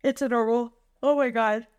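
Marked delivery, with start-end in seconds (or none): none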